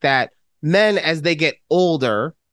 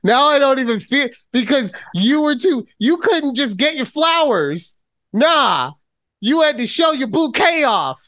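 mock scolding; 7 semitones higher than usual. Mock scolding over ruined compliment.

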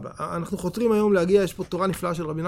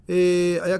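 ordinary talk

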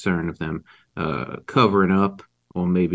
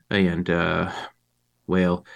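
usual speaking voice

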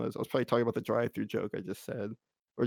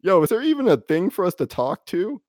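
loud incredulous; 7 semitones higher, 8 dB louder than usual. Retold or imitated. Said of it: "I go".

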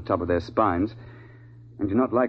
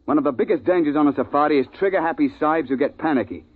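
raised, indignant voice; stern scolding; 6 semitones higher than usual. Acted. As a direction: lay down the law to a reckless client. Guide scolds trigger-happy hunter.

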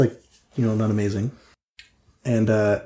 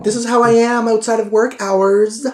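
high, loud, defensive retort; passive-aggressive Trixie; 11 semitones higher, 6 dB louder than usual. Acted. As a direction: throw the accusation back in a high-pitched voice.

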